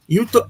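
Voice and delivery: very high, loud mocking cackle; high hammed-up laugh of an old woman; mock shrill cackle. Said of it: "This woman was like".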